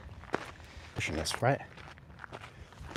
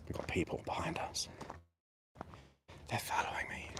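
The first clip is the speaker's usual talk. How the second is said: hushed whisper so passers-by won't hear; 14 dB quieter than usual. Real, not acted. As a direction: whisper it, hikers right behind. Hikers passing close behind.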